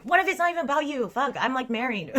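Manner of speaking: mock exasperated outburst. Retelling to her chat how she snaps at rejected men, fast mock outrage. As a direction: fire it off like a fed-up rant at a rejected guy. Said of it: "I just get so mad because I'm like".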